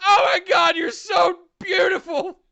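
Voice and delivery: high, loud, crying-out fangirl gush; swooning over a beautiful man; retelling meeting Tanahashi, starstruck squeal. Retold or imitated. Starstruck meeting with Tanashi retold.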